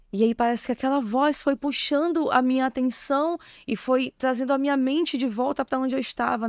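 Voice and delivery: ordinary talk, nothing marked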